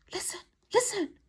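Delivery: high, hushed whisper; urgent whisper. Acted. Silky has heard something, hushed whisper. Character hushes friends to listen.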